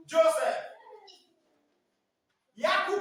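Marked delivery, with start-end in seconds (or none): 0.1-0.6 "Joseph" punched out loud and high; European form of Yusuf. Retold or imitated. Teacher announcing the European version to class, loud J sound. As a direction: hit "Joseph" hard, with a clear J. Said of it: "they will say what?".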